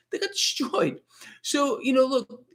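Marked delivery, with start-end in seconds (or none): 0.1-1.0 They got destroyed, pitched way up; incredulous high repeat. Real, not acted. Repeated much higher.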